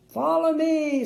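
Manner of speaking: loud, grand royal command; king's command. A narrator's reading. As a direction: lead the crowd like a king. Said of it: "said the king".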